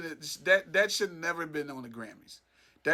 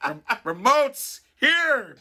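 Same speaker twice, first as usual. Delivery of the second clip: summoning power voice; 6 semitones higher, 4 dB louder, much slower than usual. Pretend. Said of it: "some powers there, like".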